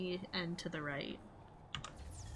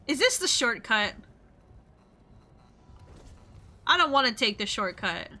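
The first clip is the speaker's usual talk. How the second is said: scared horror game streamer; 2 semitones higher, 5 dB louder than usual. Real, not acted. Thinks an enemy lurks nearby.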